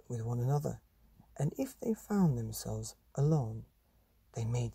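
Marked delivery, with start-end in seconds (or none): none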